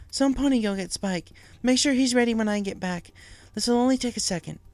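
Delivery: high, loud urgent orders; urgent Twilight. Acted. Twilight ordering ponies before teleporting, high and urgent. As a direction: rush them into action.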